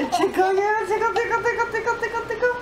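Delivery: laughing tickle chant, rising; giggly tickle attack. Real, not acted. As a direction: giggle through playful tickles.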